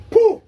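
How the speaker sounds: a sharp forceful puff of breath; loud ritual spit; ritual spitting puff. Real, not acted. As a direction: blow out hard like spitting on the spirit. Healer spits ritual breath.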